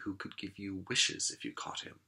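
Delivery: hushed, secret whisper. A narrator's reading. Narrator whispering to the young listeners about magic wishes. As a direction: breathe it like a secret wish.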